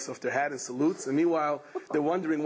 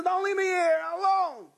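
desperate plea. Acted. Patient begging the Yerushalmis to stay, high desperate plea. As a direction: beg them not to go. Patient begs not to be left.

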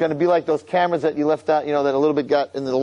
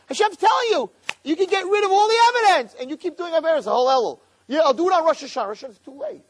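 fiery preaching; 9 semitones higher than usual. Real, not acted. Rabbi urging listeners to repent before Rosh Hashanah, fervent. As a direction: plead with the crowd not to wait.

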